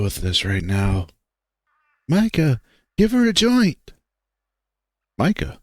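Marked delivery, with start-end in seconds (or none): 3.0-3.9 higher, urgent order. Acted. Jeremy urges Micah to calm Heather, urgent and higher. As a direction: snap it out in panic. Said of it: "increasing sense of urgency takes hold".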